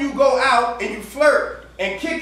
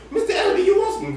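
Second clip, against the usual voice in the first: put-on high, fast little girl's voice; much higher, quick child voice asking dad to share; imitating his daughter; 8 semitones higher, much faster than usual. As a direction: chirp it like an eager kid offering pizza.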